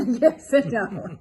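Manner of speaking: laughing through the words. Real, not acted. Laughing echo of husband's answer.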